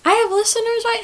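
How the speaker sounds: very high, pleading little check; needy self-doubt. Real, not acted. Podcaster doubting that anyone listens, high needy voice. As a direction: squeak it out, seeking reassurance. Seeking reassurance about having listeners.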